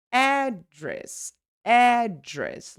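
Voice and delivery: "Address" as AAD... dress; long stressed first syllable. Shown as a demonstration. Teacher showing syllable length, exaggerated first-syllable stress on "Address". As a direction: hold a high AD in "Address", then a weak "dress". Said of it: "Look how the syllable is much longer".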